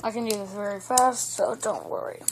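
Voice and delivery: talking while chewing gum; words around a wad of gum. Real, not acted. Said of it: "I'm chewing gum right now".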